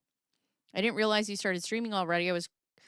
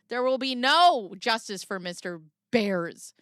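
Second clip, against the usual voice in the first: ordinary talk, nothing marked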